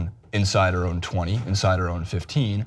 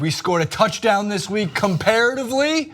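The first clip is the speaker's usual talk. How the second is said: announcer voice; 12 semitones higher than usual. Acted. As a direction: belt it like a mock TV announcer. Sports show opening announcer bit.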